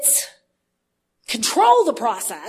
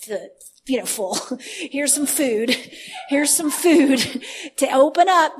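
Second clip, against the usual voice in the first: normal talking voice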